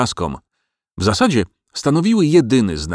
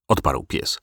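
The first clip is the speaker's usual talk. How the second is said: ordinary delivery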